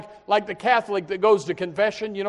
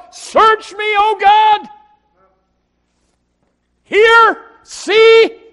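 very high, loud fervent cry; preacher crying out hymn words, high and loud; 14 semitones higher, 13 dB louder, much slower than usual. Acted. Quotes sung hymn words.

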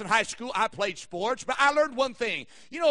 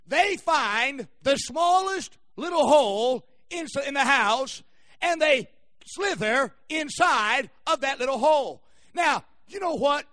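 slow, punched-out sermon storytelling; preaching; 4 semitones higher, 6 dB louder than usual. Real, not acted. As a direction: preach it big, stretching key words. Preaches the snake illustration.

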